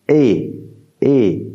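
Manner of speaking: E said as a clear é; Portuguese E. Shown as a demonstration. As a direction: sound out E for learners. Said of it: "elle se prononce". (E prononcé é)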